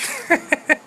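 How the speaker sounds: high giggle at own joke; high-pitched chuckle; laugh. Real, not acted. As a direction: giggle after the punchline.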